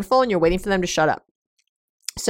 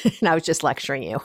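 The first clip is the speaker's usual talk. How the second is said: real chuckle at your own irony; amused admission through little laughs; laughing. Real, not acted. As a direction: talk lightly while breaking into laughter. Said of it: "I'm laughing because the next one".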